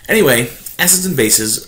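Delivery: loud bright topic opener; upbeat announcement. Real, not acted.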